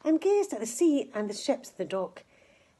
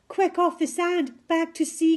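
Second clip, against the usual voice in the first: higher, louder alarmed call; urgent cry; 8 semitones higher, 5 dB louder than usual. Acted. Snail begging the stranded whale to move, urgent cry. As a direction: urge the whale back to the water. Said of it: "cried the snail".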